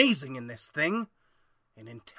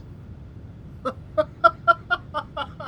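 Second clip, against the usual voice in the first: high, airy chuckle; callous breathy laugh; 11 semitones higher than usual. Acted. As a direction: laugh off the dead cat coldly. Doc laughs at the eaten cat.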